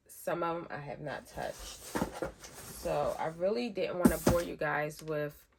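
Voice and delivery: ordinary talk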